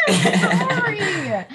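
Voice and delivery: laughing apology. Real, not acted. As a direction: giggle through the word.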